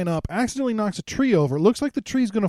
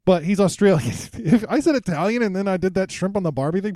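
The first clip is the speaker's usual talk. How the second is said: ordinary talk, nothing marked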